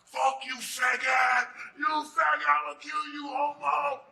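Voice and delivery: slow, high, loud screaming threat; furious scream. Retold or imitated. Mitch on the back of the car threatening him, furious scream. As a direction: howl the insult from the car roof.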